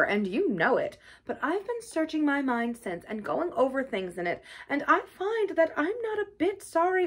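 unrepentant Toad, glib. Acted. Toad excusing himself to Badger, glib and unrepentant. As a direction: flatter freely, then refuse to repent.

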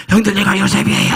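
high, loud revelation cry; emotional outcry. Acted. Joseph crying out to his brothers as he reveals himself, loud and high. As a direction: burst out with the revelation.

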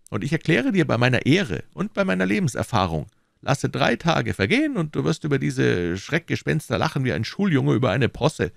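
höher gesprochener, drängender McFarland; überredet den Freund mit Nachdruck; McFarland, pushing hard. Acted. McFarland voiced. (McFarland drängt Fettes, höhere eindringliche Stimme)

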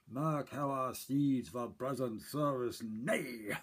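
slow, grand stage delivery of a Shakespeare line; Shakespearean line reading. Retold or imitated. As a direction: declaim it like a French constable on stage. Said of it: "he had a great line ... he says".

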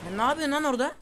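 loud, high scolding; exasperated shout. Real, not acted. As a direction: yell at a teammate's blunder. Scolding teammate.

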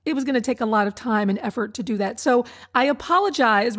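ordinary talk, nothing marked

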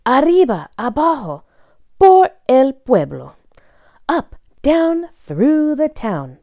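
lively sing-song picture-book reading; reading to children. Acted. Reading a bilingual dog book to young listeners, bouncy story voice. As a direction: read it like a story time for little ones.

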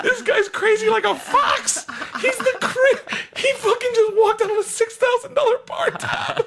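very high, helpless laughing squeal; real laughter pushing the voice sky-high; laughing through the punchline. Real, not acted. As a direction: struggle to get the words out while cracking up. Cracking up at his punchline.

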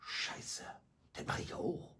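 put-on kids whispering to each other; retold whisper. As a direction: breathe it unvoiced like a caught pupil. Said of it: "kicken die Kinder sich an und sagen". (nachgemachtes Flüstern der Kinder)

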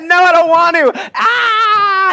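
high, loud mock tantrum wail; imitating a child crying no at bedtime. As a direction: whine and wail like a kid refusing bed. Kids refusing bed, crying.

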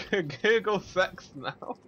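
Google sex now said slowly, Google stressed; slow push on "Google sex now"; insisting. Shown as a demonstration. Urging a friend to search it, slow and firm.

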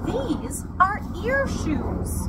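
high, slow explaining voice; story explanation. A narrator's reading. Sam explains shoes to tiger.

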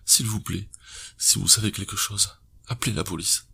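a touch of sobbing, begging; husband begs the public again, lightly sobbing. A narrator's reading. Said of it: "Sa voix est redevenue sanglotante".

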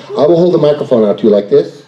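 normal talking voice

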